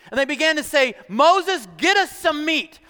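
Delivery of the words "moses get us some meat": high, pushy mock complaint; mock demand. Complaining people nag Moses for meat, demanding. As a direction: demand it in a raised, pleading pitch.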